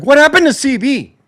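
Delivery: high, loud exasperated cry; exasperated outburst. Real, not acted. Fan ranting at what became of C B, exasperated outburst. Exasperated rant about a player.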